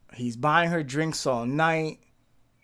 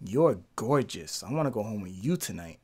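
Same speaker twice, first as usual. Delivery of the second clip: lower, softer seductive murmur; 8 semitones lower, 7 dB quieter than usual. Retold or imitated. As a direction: purr it low like she's seducing him. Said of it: "and said".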